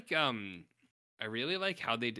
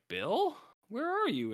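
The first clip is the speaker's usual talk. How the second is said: high, sing-out calling; searching girl; 12 semitones higher, slower than usual. Acted. Girl back with cake, calling for her brother. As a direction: call out high for someone missing.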